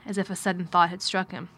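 usual speaking voice

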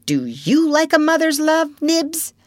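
put-on very high, loud childish question; eager child. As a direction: challenge the other boy playfully. Child teases while pillow fighting.

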